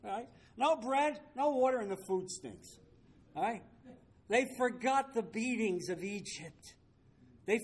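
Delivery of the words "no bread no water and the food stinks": higher, punchy mock grumble; imitated griping; mock complaining. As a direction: parody the complainers, starting high. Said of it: "They're whining".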